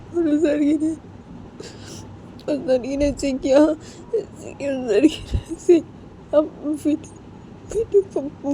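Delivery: high, sobbing, broken-up lament; crying. Acted. Poonam despairs to Payal over the girl, crying. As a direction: sob the words out in halting bursts. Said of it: "Poonam वो रो रही थी".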